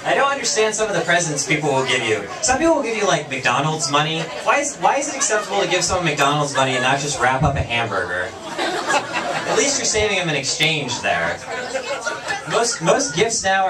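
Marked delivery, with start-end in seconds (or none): none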